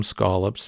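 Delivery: scallops with a broad ah, skahl-ups, rising at the end; a proud local skahl-ups, lifting up at the end; Maine scallops. Shown as a demonstration. Vlogger showing viewers his Maine way of saying scallops. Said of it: "that's how we say them".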